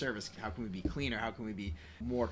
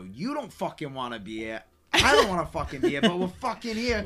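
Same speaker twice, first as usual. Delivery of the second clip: loud, high hammed-up Wolf of Wall Street accent; Donnie Azoff accent; 7 semitones higher, 10 dB louder than usual. Gym owner to his early client, loud hammed-up Azoff accent. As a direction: ham up the Donnie Azoff voice, climbing. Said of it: "joke around in that accent".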